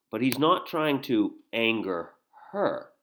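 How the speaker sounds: usual speaking voice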